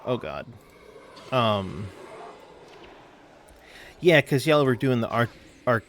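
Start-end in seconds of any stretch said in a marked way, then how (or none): none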